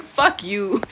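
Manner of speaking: a light imitation of an Italian accent. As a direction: mimic his little Italian accent lightly. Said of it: "in his little Italian accent".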